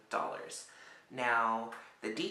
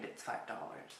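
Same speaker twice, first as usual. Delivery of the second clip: usual speaking voice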